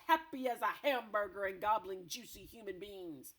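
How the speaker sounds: loud, disgusted giant rant; ranting brute giant. Acted. Blood Bottler berating the BFG, loud rant. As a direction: rant at him, loud and coarse.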